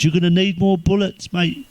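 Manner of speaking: a small high voice piping up from the back of the pub; little voice. Retold or imitated. Pub regular at the back pipes up in a small high voice. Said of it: "this little voice at the back".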